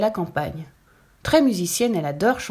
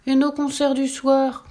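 higher and slow, sorry to lose her; saddened friends; 6 semitones higher, 4 dB louder than usual. A narrator's reading. Friends losing their evening concerts, slow and sad. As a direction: let it droop with regret, unhurried. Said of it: "s'attristent ses amis".

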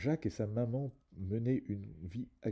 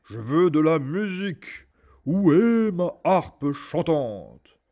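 higher, very loud and very slow demand; shouting giant; 7 semitones higher, 13 dB louder, slower than usual. A narrator's reading. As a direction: bellow for the harp. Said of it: "il cria".

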